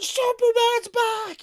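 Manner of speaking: high, drawn-out excited yell; imitating cheering kids. Acting out all the kids in the theater, excited scream. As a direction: yell it like a crowd of thrilled children. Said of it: "all the kids were like".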